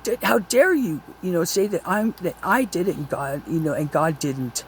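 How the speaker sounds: retelling an angry reply to Joseph about credit; angry retort. Retold or imitated. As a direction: relive the flash of anger in the quarrel. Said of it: "I got so angry with him".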